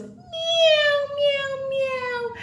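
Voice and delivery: light cat imitation for young learners; cat meowing. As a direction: meow like a little kitten. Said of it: "and a cat goes".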